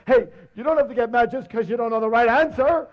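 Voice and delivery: high, loud, whiny lion; sulky beaten lion. Acted. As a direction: pout it out as the battered loser. Beaten lion sulks to elephant.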